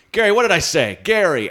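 calling out Gary. Retold or imitated. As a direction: call him out from the stage. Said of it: "I was like".

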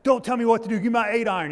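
loud, high mock tantrum; retold angry outburst. Golfer told to calm down by his friend, mock angry shouting. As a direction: snap back as an imitated hot-headed golfer. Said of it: "getting mad".

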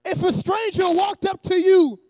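loud, emphatic sermon delivery; forceful preacher cadence; preaching. Real, not acted. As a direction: preach it to a congregation in measured phrases. Preaches to the congregation.

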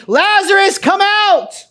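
high, loud call to the dead man; loud command. Retold or imitated. Jesus at the tomb calling Lazarus out, loud command. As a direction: ring it out, commanding him from the tomb. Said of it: "with three loud words".

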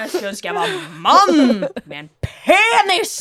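laughing, high and loud mock lament; hammed-up outburst. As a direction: build to a comic yell. Overspilt utbrudd i lekekrangel. (leende, overspilt utrop)

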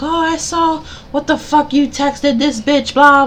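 high, shrill-free mock yell at a partner on the phone; mock phone argument. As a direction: play a jealous caller yelling down the line. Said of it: "start yelling on the phone".

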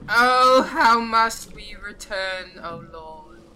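high, drawn-out wail, fading away; screeching lost soul. Acted. Lost soul disappears into the night, high screech fading off. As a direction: screech it like a ghost vanishing. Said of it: "he screeches".

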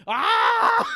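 high, loud pained cry with a laugh; mock anguished yell; anguished wail. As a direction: let out a half-laughing wail of despair. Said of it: "I'm just like".

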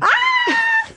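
high-pitched excited squeal; delighted squeal. Real, not acted. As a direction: shriek with giddy delight. Squeals at the sultry word.

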